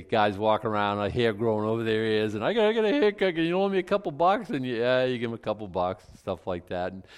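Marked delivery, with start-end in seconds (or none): 2.4-4.5 mock broke Marine pleading. Broke Marine asking for a loan.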